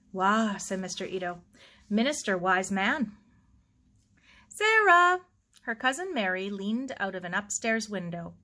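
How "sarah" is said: high, loud call across the yard. Acted. Cousin calls Sarah from an upstairs window, loud call. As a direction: shout her name from the window.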